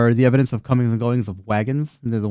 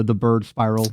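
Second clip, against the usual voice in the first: ordinary delivery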